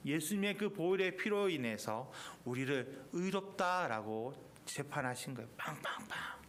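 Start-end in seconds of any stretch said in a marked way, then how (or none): 5.6-6.3 gavel bangs. Retold or imitated. Judge's gavel striking.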